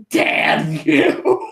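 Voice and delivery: breathy mock curse; Vegeta grumbling. Vegeta giving in to Goku, in a breathy grumbled curse. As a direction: hiss it out, beaten. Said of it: "And Vegeta's just like".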